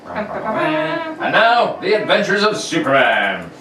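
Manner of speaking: put-on old-time radio narration; radio announcer. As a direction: narrate like a 1940s serial.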